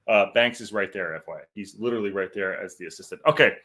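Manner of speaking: normal talking voice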